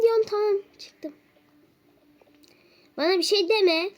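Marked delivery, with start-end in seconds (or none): 3.0-3.9 irritated. Real, not acted. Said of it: "Çok sinirliyim ben".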